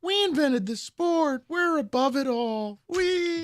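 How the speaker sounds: high, slow, snooty mock fan voice; lofty know-it-all sports fan; smug Canadian fan. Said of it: "I just view Canadians like Michigan fans".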